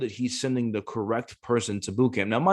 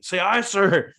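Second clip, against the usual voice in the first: a higher, louder drill instructor bark; mock drill instructor; 9 semitones higher, 5 dB louder, slower than usual. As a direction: imitate the drill instructor ordering him. Drill instructor barking in background.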